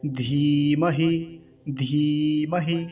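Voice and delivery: धीमहि with a long held धी and quick हि; long EE, short I. Shown as a demonstration. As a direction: stretch धी in धीमहि, cut हि short. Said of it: "तो कैसे उच्चारण करेंगे". (धीमहि में धी लंबा खींचकर, हि छोटा)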